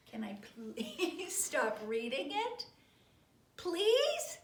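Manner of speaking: slow plea rising to a high, loud please; beg, stretching out each please; mock pleading. Reader begs to stop, drawn-out mock-desperate plea.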